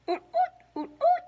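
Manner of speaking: high loud oot grunts; loud pig oinks. A narrator's reading.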